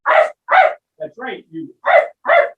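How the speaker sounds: very high little dog arfs; high yappy barks. Acted. Little dog yapping to be fed. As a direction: yap like a hungry pup.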